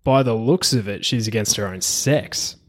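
ordinary talk

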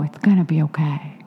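low, hushed reassurance; soft, understated and near a whisper; imitating straight-faced calm. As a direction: mimic someone quietly unruffled. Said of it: "really do have a straight face".

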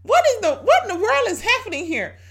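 high, loud, startled outcry at her own flesh; shocked outcry. Retold or imitated. Preacher recalling her shock at her own sinful urges, loud startled cry. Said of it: "I was like".

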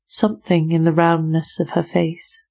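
usual speaking voice

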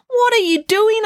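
high, loud astonished question; shocked, very high demand; alarmed question. A narrator's reading. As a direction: call it out in disbelief. Finn shocked at April's climbing.

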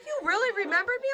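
high, fast, tickled to be remembered; flattered surprise. Retold or imitated. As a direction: gush like a flattered regular. Flattered customer voiced.

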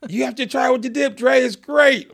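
high, loud insistence; excited urging. Retold or imitated. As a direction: push the dip on him. Friend urging him to try dip.

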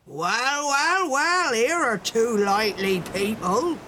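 lightly voiced old salt, rolling and lilting; hearty sea captain. A narrator's reading. Sea captain spotting the dancing pair, heartily calling. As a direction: greet them as a jovial captain might. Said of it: "he cried".

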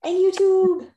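high loud teasing chime; playful reminder. Real, not acted. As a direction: call it out, teasing.

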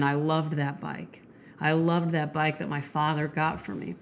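soft, subdued soliloquy with long pauses; hushed, reflective monologue, lines left to hang; dramatic monologue reading. Acted. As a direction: read it quietly, like a man remembering. Repeats the monologue's closing lines.

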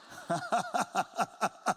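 staccato run of ha-ha-ha; put-on laugh. Preacher laughs off his fright before the men, staccato laugh. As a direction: laugh it off in even bursts. Sheepish laugh after excuse.

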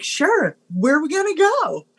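high-pitched, lightly mocked eager self; eager acceptance. Young woman accepting her date's invitation, mock-eager. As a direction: play a naively agreeable date, pitched high.

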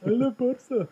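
high mock-adoring squeal; high, teasing fake enthusiasm; mocking fan. Mocking the Barca fans.

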